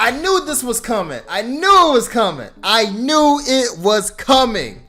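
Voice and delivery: excited shout. Real, not acted. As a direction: shout it out, thrilled you called it. Reacting excitedly to the punchline.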